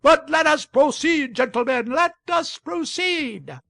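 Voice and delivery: high, loud call over a noisy hall; insistent shouting. A narrator's reading. Chair hammering his gavel at the crowd, insistent shout. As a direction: shout to be heard above the uproar. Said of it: "and kept shouting".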